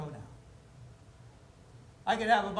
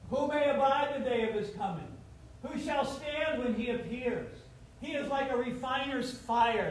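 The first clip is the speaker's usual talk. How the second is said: solemn scripture reading; 5 semitones higher than usual. Acted. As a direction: intone the prophecy gravely. Reading Malachi aloud.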